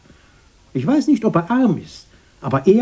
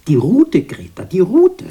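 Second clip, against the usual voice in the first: taunting call; 7 semitones higher, 6 dB louder than usual. Acted. Taunting his sister.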